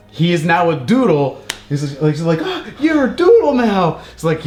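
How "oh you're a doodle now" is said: high, astonished character voice; mock wonder at a sudden transformation; amazed gasp. Acts out amazed lady friend.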